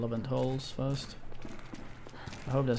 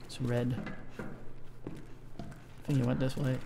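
whispering; 6 dB quieter, much slower than usual. Real, not acted. Horror game, creeping along.

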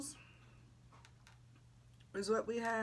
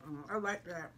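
talking between bites; eating while speaking; mouth full chewing; 3 semitones lower, 5 dB quieter, faster than usual. Real, not acted. Seen 0: Woman put food in mouth at -5s, jaw moving while speaking.

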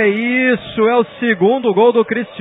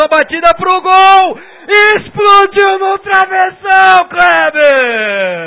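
shouted near miss; 7 semitones higher, much slower than usual. Real, not acted. Shot hits the crossbar.

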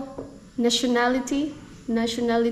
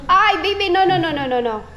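higher, louder urgent no no no; 7 semitones higher, 4 dB louder, faster than usual. Real, not acted. Stopping baby grabbing things.

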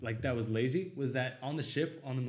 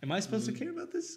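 mock disbelief; 11 semitones higher than usual. Acting out his reaction to ending.

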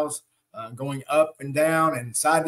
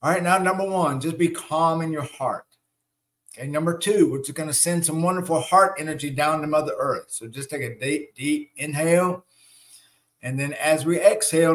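guided meditation. Acted. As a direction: ease the group into breathing calmly.

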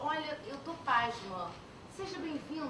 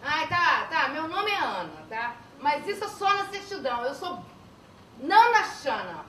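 loud and proud; 10 dB louder than usual. Acted. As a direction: announce the name boldly. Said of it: "Ela tá falando pra dentro".

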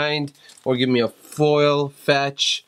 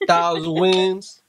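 slow, higher Southern drawl; mock Southern accent; 4 semitones higher, slower than usual. Husband cracking up his wife, slow mock Southern accent. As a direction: stretch it high in a fake Southern accent. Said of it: "If you're Southern and talk like this".

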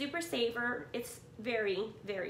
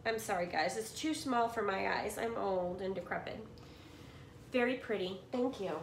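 ordinary delivery

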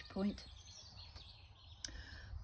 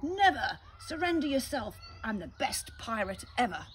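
high, loud pirate roar; 4 semitones higher, 6 dB louder than usual. Acted. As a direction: roar defiance back at him. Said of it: "Redbeard roared".

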